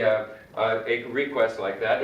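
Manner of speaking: usual speaking voice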